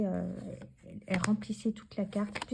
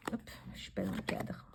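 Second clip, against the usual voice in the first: whispered aside; 14 semitones lower, 11 dB quieter than usual. Real, not acted. Aside while adjusting the frame.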